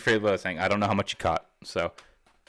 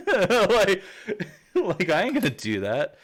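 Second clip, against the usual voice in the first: laughing; 11 semitones higher than usual. Real, not acted. Laughing after mock plea.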